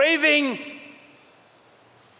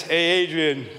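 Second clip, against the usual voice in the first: low, mumbly Rocky Balboa impression; a rough Rocky Balboa growl; 5 semitones lower than usual. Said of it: "That's my terrible Rocky voice".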